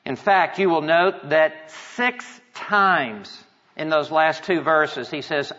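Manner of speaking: ordinary delivery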